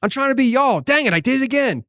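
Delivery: mock-flustered, defending himself to the locals; flustered apology. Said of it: "I'm like".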